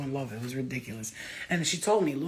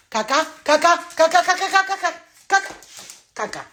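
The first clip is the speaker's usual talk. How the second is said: very high, loud sing-song calling; high cat call; 11 semitones higher, 7 dB louder than usual. Real, not acted. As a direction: coax the cats in with a squeaky call.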